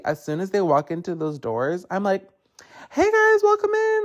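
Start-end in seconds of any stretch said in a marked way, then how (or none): 2.9-4.1 much higher and louder, sugary customer-service welcome; fake cheerful greeting. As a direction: put on a bright fake smile for customers. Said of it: "it's called faking it till you make it".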